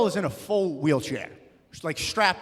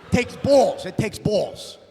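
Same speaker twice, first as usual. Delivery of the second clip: loud deli guy; 5 semitones higher, 5 dB louder than usual. Retold or imitated. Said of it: "He's like".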